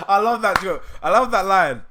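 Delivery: ordinary talk, nothing marked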